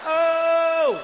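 high yelled Oh; friends yelling from behind. Retold or imitated. Friends behind on the trail yelling, a high loud shout. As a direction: shout it like friends calling out far back. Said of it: "they're like".

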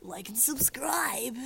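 slow, breathy whisper; drawn-out hushed whisper; whispered sign-off. Pretend. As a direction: whisper the outro to the audience.

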